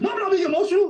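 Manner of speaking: shrill, high mock yell of her protest; mock screaming denial. Mimicking a podcast guest yelling she isn't emotional. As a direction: imitate her screaming that she's calm. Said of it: "why are you screaming like this".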